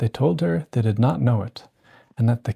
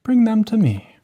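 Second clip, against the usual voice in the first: raised, lightly voiced godmother; a kindly fairy giving orders; 10 semitones higher, 3 dB louder than usual. A narrator's reading. Godmother instructing Cinderella, lightly raised voice.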